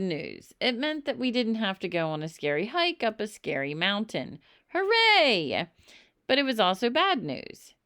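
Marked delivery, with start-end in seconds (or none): 4.7-5.7 high, loud hooray; bright little victory cheer. A narrator's reading. Narrator cheers in story.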